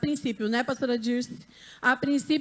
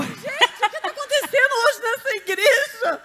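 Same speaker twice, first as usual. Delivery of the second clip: high, laughing near-wail; flustered surprise; 9 semitones higher, 4 dB louder than usual. Real, not acted. Surprised speaker asks the church what is happening, high and flustered. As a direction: exclaim in overwhelmed, tearful amusement.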